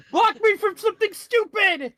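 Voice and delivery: very high, far put-on voice; panicked plea. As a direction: squeal for help.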